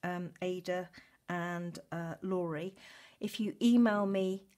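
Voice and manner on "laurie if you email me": ordinary talk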